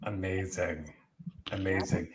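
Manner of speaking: ordinary talk, nothing marked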